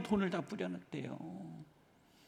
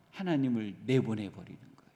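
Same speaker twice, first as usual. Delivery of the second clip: normal talking voice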